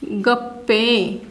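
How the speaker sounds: गप्पे very slow and low, split at the doubled प; pronunciation demo. Shown as a demonstration. Teacher presents one word to the class, slow split pronunciation of गप्पे. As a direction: say गप्प-पे slowly, holding the last vowel. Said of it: "ये एक शब्द है".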